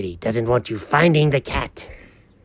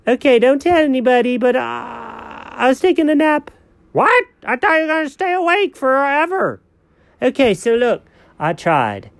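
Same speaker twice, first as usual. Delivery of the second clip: sleepy cat voice; 8 semitones higher than usual. Acted. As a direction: whine like a lazy cat caught napping. Cat character in skit.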